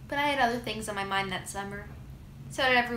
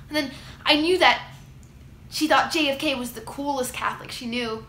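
ordinary delivery

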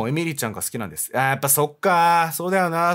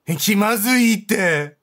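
cringing; 6 semitones higher, 5 dB louder, much slower than usual. Real, not acted. Reacting to awkward scene.

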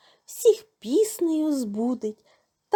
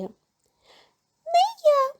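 high squeaky mouse; squeaking. A narrator's reading. Mouse squeaks a refusal to the rooster, squeaking. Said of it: "пищить круть".

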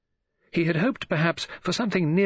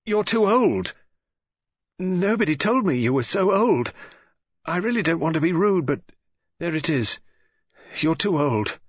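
put-on high, louder girlish outburst; blurted out; 7 semitones higher, 4 dB louder than usual. A narrator's reading. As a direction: burst out with it, then ease off a little. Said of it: "and suddenly blurted out".

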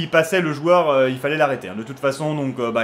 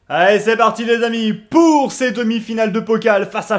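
higher, louder kickoff call; match announcer; 4 semitones higher, 6 dB louder than usual. Pretend. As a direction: launch the semi-final like an announcer. Launches the match for viewers.

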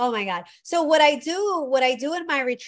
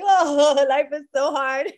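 mock lament; 3 semitones higher, 6 dB louder, slower than usual. Mocking disheveled ceremony goers.

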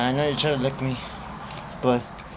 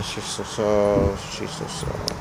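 tender whisper; 6 semitones lower, 6 dB quieter, slower than usual. Real, not acted. Petting the dog tenderly.

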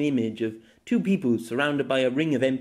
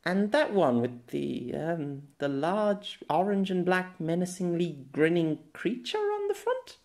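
put-on curious girl; 5 semitones higher, slower than usual. Girl asks about the cereal box.

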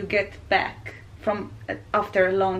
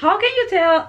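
shocked; 6 semitones higher, 5 dB louder than usual. Retold or imitated. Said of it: "I was like".

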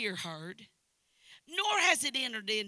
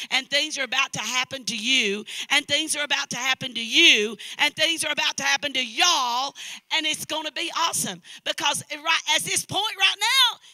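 loud repeated promise, climbing to a high shout; building preaching; 4 semitones higher, 13 dB louder than usual. Real, not acted. Preacher promising the church great things, full-force building preaching. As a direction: drive each repetition harder.

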